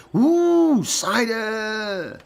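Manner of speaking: very high, loud cheer for cider; excited whoop. Real, not acted. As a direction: whoop it out with glee. Excited about Nikki's cider.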